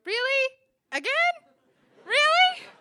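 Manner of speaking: very high, loud incredulous outburst; exasperated disbelief. Retold or imitated. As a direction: shout each question separately, incredulous. Exasperated at another breakup.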